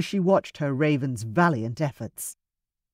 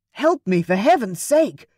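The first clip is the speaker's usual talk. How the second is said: higher, louder desperate plea; gasped plea; 5 semitones higher, 5 dB louder than usual. A narrator's reading. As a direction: plead for help while half drowning.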